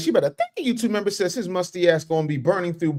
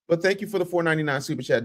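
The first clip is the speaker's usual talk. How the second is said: ordinary talk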